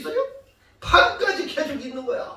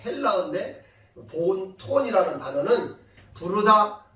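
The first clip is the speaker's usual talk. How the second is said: ordinary talk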